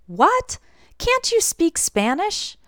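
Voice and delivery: high-pitched, incredulous disbelief; astonished, slow rising question; surprised question. Acted. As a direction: ask it taken aback, voice leaping up. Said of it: "I'm just surprised".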